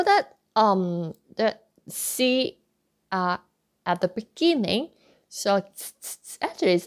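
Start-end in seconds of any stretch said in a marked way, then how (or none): 5.6-6.4 "ts-" as a short voiceless tongue hiss; isolated ts sound. Shown as a demonstration. Teacher explains the beginning C, bare "ts-". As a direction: release "ts-" with no vowel after it. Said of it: "it pronounced ts".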